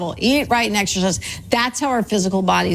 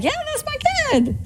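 excited proud parent; faster than usual. Acted. Parent cheering their kid on the soccer field, high excited cheer. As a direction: act out a parent's squeal of pride.

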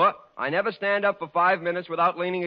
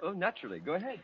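muttered aside; 11 dB quieter, faster than usual. Acted. Muttered aside between friends.

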